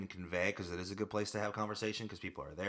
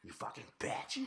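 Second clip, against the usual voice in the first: whispered insult; 5 dB quieter than usual. Pretend. Joking follow-up insult.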